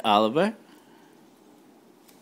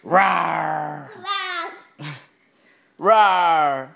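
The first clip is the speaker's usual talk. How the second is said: pirate-style rawr, getting louder; 3 dB louder than usual. Pretend. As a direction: growl it like a playful pirate. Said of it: "Sound like a pirate".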